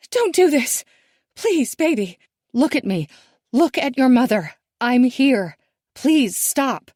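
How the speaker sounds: high, loud desperate begging; frantic pleading. A narrator's reading. Mother pleading with her daughter on the cliff, frantic. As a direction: beg her to stop, frantic.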